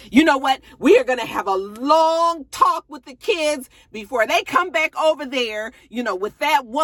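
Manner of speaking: high and loud threat; defiant threat. Retold or imitated. Ex-wife threatening a talk with the kids, loud and high. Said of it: "she was like".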